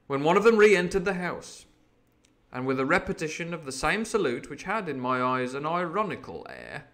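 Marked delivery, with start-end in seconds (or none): none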